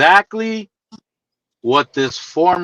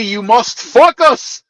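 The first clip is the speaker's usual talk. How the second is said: slow, higher mock Greek coworker, "fuck us" for focus; heavy Greek accent; 6 semitones higher, slower than usual. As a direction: repeat the coworker's line in his thick accent. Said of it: "That's that heavy ass accent".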